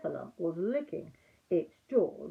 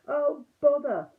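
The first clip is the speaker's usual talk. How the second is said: slow, loud, higher groan of bother; muffled bother; 8 semitones higher, 8 dB louder, slower than usual. A narrator's reading. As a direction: grumble from inside a honey jar. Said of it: "he said inside the jar".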